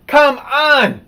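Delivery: loud, high exasperated outcry; exasperated protest. Real, not acted. Ranting to viewers about masks: loud, high exasperated cry. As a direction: throw it up high and loud in disbelief.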